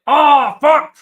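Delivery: loud high cry of frustration; anguished shout. Real, not acted. Fan screaming at a missed chance, loud and high. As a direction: yell out at the near miss. Near miss reaction.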